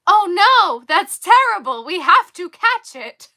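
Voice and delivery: high, loud and urgent; alarmed little kid. Retold or imitated. Young me begging dad to catch the bunny, loud and high. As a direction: squeal it like a panicked child wanting to save the bunny. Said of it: "And I was like".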